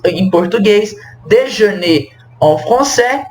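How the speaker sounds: normal talking voice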